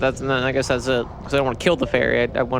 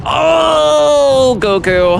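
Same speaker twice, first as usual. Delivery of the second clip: mock anguished cry; 11 semitones higher, 9 dB louder, much slower than usual. Mock dramatic cry to Goku.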